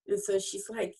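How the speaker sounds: ordinary delivery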